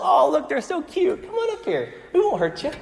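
very high cooing at bunnies; mocking baby talk. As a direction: coax them with fake sweetness, laughter underneath. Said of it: "They seem like two little bunnies".

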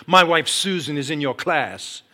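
loud, slow, confronting husband; aggressive caller. Retold or imitated. Husband phones his wife's teacher, aggressive. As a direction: confront them loudly, weighing each word. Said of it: "kind of aggressive in his voice".

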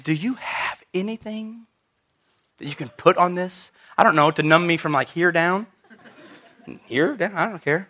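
soft, timid mock plea; wussy pleading. Hurt teacher asking the nurse for something to numb it, wussy. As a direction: imitate yourself meekly begging for numbing. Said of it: "I was a little bit of a wuss".

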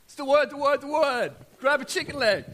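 high, loud, hurried mock panic; snatch the words like grabbing food on the run; hammed-up frantic grab at God's table; frantic rushing.

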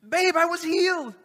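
high, loud, thrilled announcement; excited husband. Retold or imitated. A healed husband tells his wife, high and thrilled. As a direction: burst in with the big news. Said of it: "and they said".

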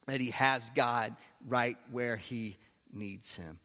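usual speaking voice

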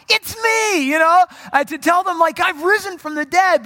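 high, loud eager blurting; excited blurt. Retold or imitated. Jesus blurting it out to his friends, excited and loud. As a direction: burst out with the good news. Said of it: "blurt it out".

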